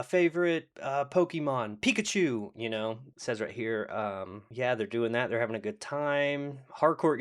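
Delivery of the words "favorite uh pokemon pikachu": high-pitched, eager quick-fire exchange; Step Brothers joke. Retold or imitated. Acting out two doubles doing the Step Brothers joke, excited. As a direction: blurt question and answer in a high, excited voice. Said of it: "the Step Brothers joke".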